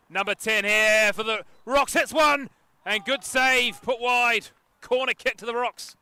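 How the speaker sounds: loud, high play-by-play; excited match call. Real, not acted. Commentator calls a shot and good save, excited.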